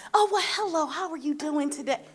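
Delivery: high, put-on polite greeting voice; mock the stiff, proper version of herself; hammed-up refined small talk; prim proper voice. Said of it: "I ain't talked like that a day in my life".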